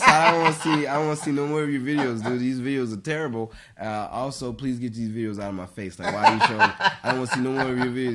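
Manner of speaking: loud, laughing plea to stop showing videos; exasperated protest. Retold or imitated. To a friend pushing his phone videos, loud laughing protest. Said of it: "I was like".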